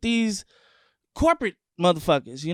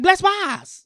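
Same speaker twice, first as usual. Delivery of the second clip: high mock voice saying Bless Wise; high, put-on voice of the girl who got the name wrong; mocking mispronunciation; 13 semitones higher, 6 dB louder than usual. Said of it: "you sound like a Chinese lady".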